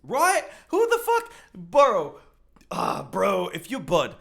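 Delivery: loud, high, worked-up disbelief; heated and incredulous; indignant outburst. Real, not acted. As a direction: shout it at the screen. Indignant reaction to the theory.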